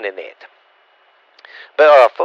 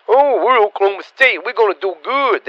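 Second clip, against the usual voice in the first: higher sing-song voice, mocking a rival's bragging; mocking boast; 8 semitones higher than usual. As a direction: put on a smug voice to make fun of them.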